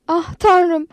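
high, alarmed exclamation; crying out. A narrator's reading. Anita seeing the unconscious girl, crying out. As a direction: cry out in shock, pitch leaping. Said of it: "diye bağırdı Anita".

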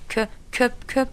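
halting, repeated word starts; stuttering. A narrator's reading. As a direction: struggle to get the word out. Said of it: "diye zorlandıktan sonra".

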